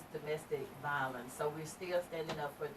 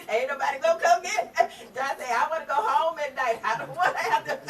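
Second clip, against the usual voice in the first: laughing while talking; 9 semitones higher, 11 dB louder than usual. Real, not acted. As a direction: talk through real laughter, louder and higher. Joking about getting beat up.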